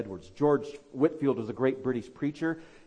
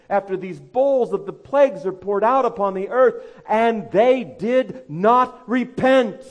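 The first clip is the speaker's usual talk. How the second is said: higher and louder preaching, slowing hard on the last line; fiery preaching; 8 semitones higher, 9 dB louder than usual. Acted. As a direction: press the warning home from the pulpit. Sermon rising to full force.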